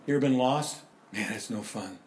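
ordinary talk

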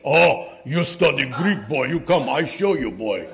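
mock Greek accent of a tough old grandpa. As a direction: imitate an old Greek grandfather inviting the boy. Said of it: "Grandpa Zachis he loved me".

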